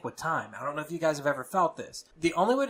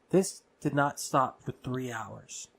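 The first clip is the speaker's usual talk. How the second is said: normal talking voice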